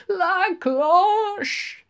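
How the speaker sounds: high, loud delighted shout; triumphant cry of la cloche; gleeful cry. A narrator's reading. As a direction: crow it with glee. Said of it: "cried gleeful Lenehan".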